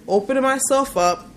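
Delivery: usual speaking voice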